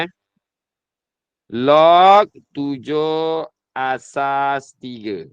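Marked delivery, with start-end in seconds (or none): 1.5-5.3 Log tujuh asas tiga, every word stretched out very slowly; slow read-aloud. Shown as a demonstration. Teacher showing new students how to say it, very slow. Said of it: "ini sebutan dia".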